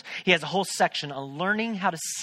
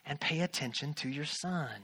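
little inner voice; 3 semitones lower, 7 dB quieter than usual. Retold or imitated. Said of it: "this little voice say".

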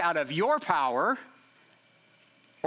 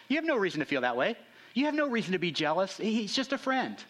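mock dismissive spouse; 6 semitones higher than usual. Shown as a demonstration. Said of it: "invalidated your emotions".

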